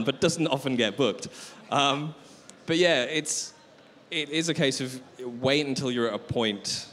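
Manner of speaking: ordinary talk, nothing marked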